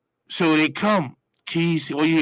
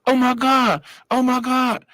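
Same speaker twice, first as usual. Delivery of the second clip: loud, high, frantic oh my God; panicked guards; 12 semitones higher, 7 dB louder than usual. Retold or imitated. Guards finding the body, loud and frantic. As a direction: freak out at the sight. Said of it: "So they're like".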